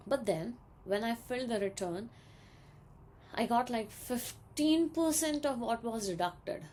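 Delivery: ordinary talk, nothing marked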